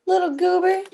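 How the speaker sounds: squeaky pet talk; high cutesy pet voice. Real, not acted. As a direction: coo it up high at the cat. Repeats to cat, second higher.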